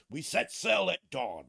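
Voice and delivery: loud pirate call to the crew; hammed-up pirate. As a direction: bellow the order to set sail. Pirate bit continues.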